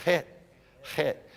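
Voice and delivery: "het" repeated with a breathy H; windy. Shown as a demonstration. Repeating the letter for students to copy, windy "het". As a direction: separate each "het", airy at the start. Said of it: "The het's more windy".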